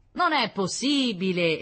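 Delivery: loud, drawn-out disbelief; dismayed. A narrator's reading. Local policeman rejecting the accusation, dismayed. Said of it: "esclamò sgomento il vigile Meletti".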